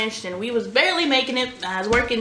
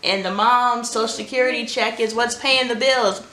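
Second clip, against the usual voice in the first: usual speaking voice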